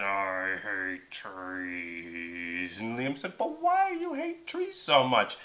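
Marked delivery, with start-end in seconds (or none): 0.0-2.8 low, very slow creature voice; soft, deep, drawn-out grumble; grumpy dragon. Acted. Dragon voice in story. 3.4-5.4 very high, protesting voice; high-pitched indignant argument; exasperated boy hero. Acted. Liam argues with dragon.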